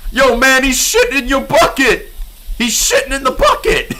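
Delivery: high, loud laughing yell; loud shout. Real, not acted. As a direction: yell out in disbelief. Shouting at a game scene.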